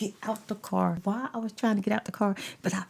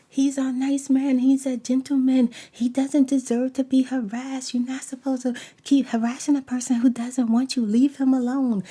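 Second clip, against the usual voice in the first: loud, higher mock of the onlookers; mocking cheerleaders; 4 semitones higher, 7 dB louder than usual. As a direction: parrot the bystanders loudly and sarcastically. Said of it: "they out there talking about".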